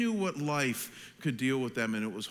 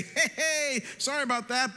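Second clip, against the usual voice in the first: high, breezy pretend condolence; mock cheery greeting; 9 semitones higher than usual. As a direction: greet mourners with put-on cheer. Acting unthinkable cheery funeral greeting.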